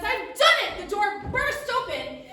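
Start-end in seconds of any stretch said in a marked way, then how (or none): 0.0-0.8 high, loud, triumphant little gnome. Acted. Gnome alchemist cries out over his bubbling potion, high and loud. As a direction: crow it like a tiny gnome who succeeded. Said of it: "just as he says".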